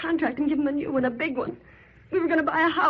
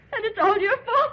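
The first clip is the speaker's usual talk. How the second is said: tearful blame; 7 semitones higher than usual. Acted. Wife blames Babe, near tears.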